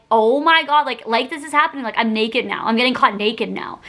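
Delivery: ordinary talk, nothing marked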